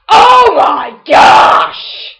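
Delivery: loud, high, very slow wail; anguished shout. Real, not acted. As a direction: stretch out a despairing cry. Embarrassed at losing.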